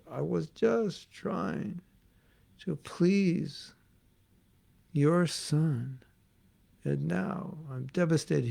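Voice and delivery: slow, soft, grieving; mild tearful plea to the picture; tearful lament. Retold or imitated. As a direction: devotee lamenting softly before his guru's picture. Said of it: "he started crying".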